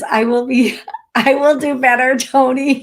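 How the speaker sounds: amused, giggling while reading a viewer's reply; laughing. Real, not acted. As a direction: read it through real laughter.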